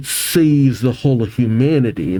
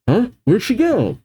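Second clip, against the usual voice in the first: calling out; 10 semitones higher than usual. Acted. Grunts call out searching.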